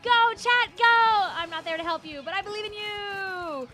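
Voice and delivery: rally them like a coach; cheering. Real, not acted. Cheering chat on.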